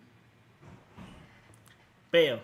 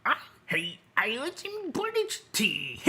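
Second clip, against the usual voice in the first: slow, higher whiny voice, stressing British; annoying pathetic voice; 7 semitones higher than usual. Acted. Streamer forced to say it for chat, whiny and pathetic. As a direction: whine it out as pathetically as possible. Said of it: "most annoying, pathetic way possible".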